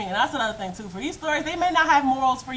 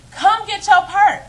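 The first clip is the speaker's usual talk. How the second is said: higher, louder, slow mock order; slow angry demand; 8 semitones higher, 7 dB louder, slower than usual. Boyfriend insists she fetch her purse, slow mock anger. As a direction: drag each word out like a stubborn demand. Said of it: "mad as I don't know what".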